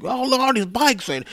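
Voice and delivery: put-on high, strained cranky old-man rant; old man yelling. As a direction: rail at everything like a crazed old man. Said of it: "like an old man yelling at everything".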